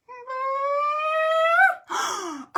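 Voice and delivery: high loud hammed-up gasp; theatrical gasp of surprise for kids; big surprised gasp. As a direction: gasp like you're shocked by the page. Mock shock at book.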